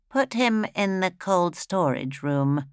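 slow, deliberate doctor's order; a light touch of a cool doctor; doctor giving orders. Acted. Doctor instructs the steward.

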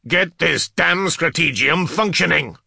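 loud, high furious order; enraged. Acted. New commander to startled adepts, enraged. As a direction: bellow the order in a rage. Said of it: "Startled at his rage".

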